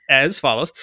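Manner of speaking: playing up lawyerly phrasing for laughs; mock lawyer voice. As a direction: declaim it like a pompous lawyer. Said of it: "[name]'s being a lawyer too".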